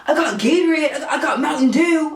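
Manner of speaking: high-pitched mocking imitation; mock clueless driver. Uber driver offering drinks to a sprayed rider, mock clueless. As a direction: lightly send up a dumb, eager helper. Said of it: "the Uber's dumbass was like".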